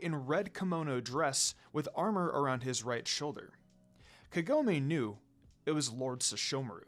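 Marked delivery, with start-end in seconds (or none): none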